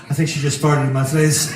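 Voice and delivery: a hint of a dazed buddy; low stunned voice. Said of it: "he just looks up and goes".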